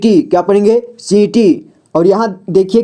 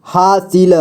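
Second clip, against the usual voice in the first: हासिल slowly as हा-सि-ल; syllable by syllable; slower than usual. Shown as a demonstration. Teacher showing beginners how to read हासिल, syllable by syllable. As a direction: sound out each letter of हासिल. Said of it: "जोड़ जोड़ करके ही पढ़ना होगा".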